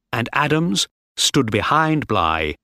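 usual speaking voice

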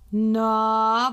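loud mock grumble; hammed-up gruff Saint Nicholas "Na"; grumbling Nikolaus. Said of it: "brummt Schnüpperle".

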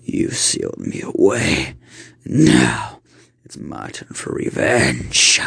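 low, slow growling demon; menacing demonic threat; angry demon. Acted. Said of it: "with a anger in its voice".

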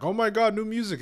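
sudden high, loud gush of joy; excited squeal. Retold or imitated. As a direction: squeal it like a thrilled fan hearing the news. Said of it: "we're all gonna be like".

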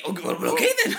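very high, loud happy dog; eager dog voice. Retold or imitated. Voicing the dog obeying the burglar, very high. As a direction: squeal agreement like a dopey pup. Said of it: "The dog's like".

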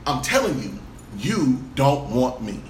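low, slow, drawn-out accusation; slow insistence. Retold or imitated. Tearful date insists he doesn't want her, slow and low. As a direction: spell it out slowly, word by word.

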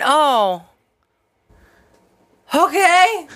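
high, loud mock-chipper sign-off; forced customer-service brightness with awkward gaps; awkward shop clerk. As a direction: imitate a clerk politely backing out. Voices clerk's awkward goodbye.

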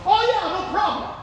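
breezy, very high voice; hammed-up cheerful reply; mock easy agreement. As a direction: brush it off cheerily. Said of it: "And they go".

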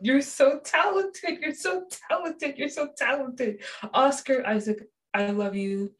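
gushing fan praise for Oscar Isaac. Real, not acted. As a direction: repeat the praise like a starstruck fan.

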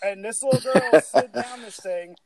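high, loud, amused telling through laughter; laughing while talking. Real, not acted. Amused at the absurd slide.

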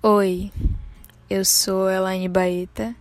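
ordinary talk, nothing marked

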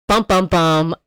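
usual speaking voice

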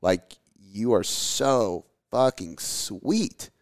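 very slow, drawn-out gushing; melting with affection. Retold or imitated. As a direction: draw the words out, overcome by a child's sweetness. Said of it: "you're melting my heart right now".

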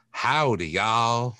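high, twangy cowboy Texan howdy. Shown as a demonstration. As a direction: ham up a cowboy Texan drawl. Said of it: "but we're not like".

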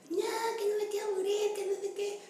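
high-pitched panicked sister yelling; mock a panicked sister wailing she doesn't want to die; panicked scream. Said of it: "mi hermana empieza a gritar". (hermana gritando aterrada con voz muy aguda)

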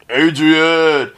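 lightly done, badly faked Stallone mumble; a half-hearted Sylvester Stallone call; shitty Stallone. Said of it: "that's my shitty Stallone".

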